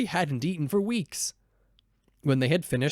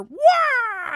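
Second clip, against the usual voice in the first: high, loud shriek of terror; a sudden frightened wail; terrified scream; 8 dB louder than usual. Acted. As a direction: shriek in panic. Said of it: "The robber screamed in terror".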